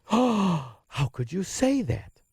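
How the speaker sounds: slow, hammed-up gasp of outrage; mock horrified gasp then slow question; mock gasp of shock. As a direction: play the scandalised listener, drawn out. Mock shocked reaction to own claim.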